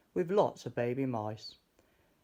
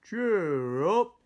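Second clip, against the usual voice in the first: hearty cheer; 7 semitones higher, 8 dB louder than usual. Acted. George comforts the mice.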